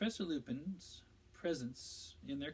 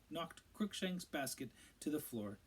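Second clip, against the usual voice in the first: plain reading voice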